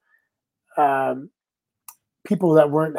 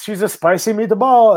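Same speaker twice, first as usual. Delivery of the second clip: mock Italian accent; 6 semitones higher than usual. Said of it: "write in my accent".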